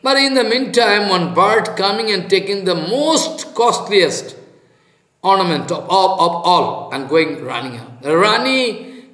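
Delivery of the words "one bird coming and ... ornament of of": ordinary delivery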